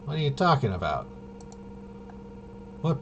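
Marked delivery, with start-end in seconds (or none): none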